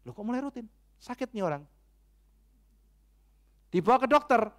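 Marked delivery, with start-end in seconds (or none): 0.0-1.6 softer, higher puzzled murmur; musing father. Acted. Papa starts wondering about the routine screaming, quiet puzzled musing. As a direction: think it aloud quietly, as a worried parent. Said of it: "Lalu papanya mulai mikir".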